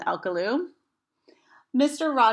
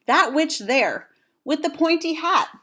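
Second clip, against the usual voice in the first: emphatic pointing; 8 semitones higher, 5 dB louder than usual. Acted. Pointing out the witch.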